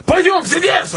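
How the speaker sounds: high barked Russian order; hammed-up police command, very high; commanding cop. Cop barks an order at him.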